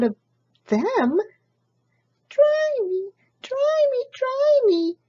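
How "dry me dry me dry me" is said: very high chirping chick voice; demanding baby chick. Acted. Soggy baby chick demands drying, very high. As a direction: chant a needy demand. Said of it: "cried the soggy baby chick".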